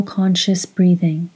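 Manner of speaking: ordinary talk, nothing marked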